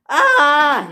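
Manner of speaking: high, loud acted scream; high yell of alarm. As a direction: scream "ah" as the wind lifts you. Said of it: "he screamed".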